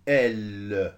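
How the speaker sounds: "L" pressed loud with a long held l sound; leaning on L. Shown as a demonstration. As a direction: lean hard on "L", stretching the consonant. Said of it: "J'appuie sur le L". (« L » très appuyé, consonne prolongée)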